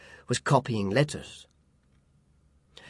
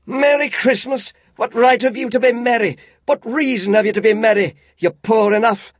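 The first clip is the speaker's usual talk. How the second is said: loud, high mocking tirade; scornful rant; 9 semitones higher, 7 dB louder than usual. A narrator's reading. Scrooge mocking his nephew's cheer, scornful rant. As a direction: sneer at every word.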